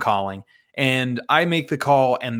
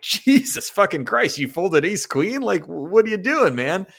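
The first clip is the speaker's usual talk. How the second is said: inner disbelief; 6 semitones higher than usual. Retold or imitated. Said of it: "inside, I just start, like, roaring with laughter".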